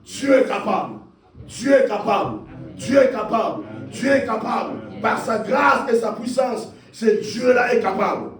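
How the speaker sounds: impassioned preacher proclaiming a promise; full-force preaching. Real, not acted. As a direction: declare it with rising fervour, then hammer the refrain.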